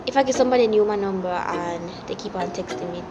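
normal talking voice

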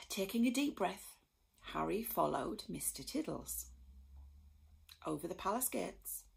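ordinary delivery

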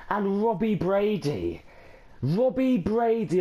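high, drawn-out excitement on the scorer's name; excited build-up. Real, not acted. Fan replaying the winning header to viewers, excited. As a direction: call the name like the goal is going in.

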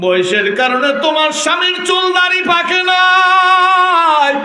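high, loud, drawn-out chant; melodic preaching lament. Acted. Preacher voicing the Prophet consoling Aisha, high drawn-out chant. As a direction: stretch the line endings in a loud wail. Half-sung sermon dialogue.

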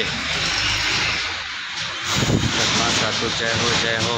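calling out loud so viewers can hear; loud, projected call-out; loud on request. Real, not acted. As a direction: raise the voice, almost shouting. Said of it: "कितना चिल्लाए गला फाड़ के".

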